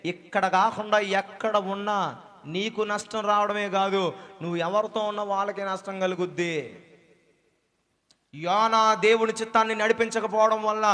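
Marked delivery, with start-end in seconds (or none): none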